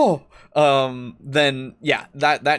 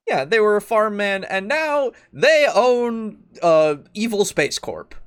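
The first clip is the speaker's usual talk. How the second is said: higher and louder, slowing on the twist; excited, mounting disbelief; 8 semitones higher, 6 dB louder, slower than usual. Pretend. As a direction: build to the absurd twist. Escalated version of the same line.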